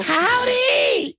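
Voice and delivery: a very high hammed-up Texan "Howdy!"; mock Southern hello. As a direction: yelp it like a cartoon cowboy. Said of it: "when two Texans see each other".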